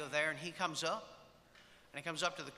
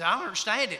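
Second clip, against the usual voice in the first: louder, exasperated puzzlement; mock bafflement; 5 semitones higher, 8 dB louder than usual. Friend walking away baffled by the marriage, loud mock bewilderment.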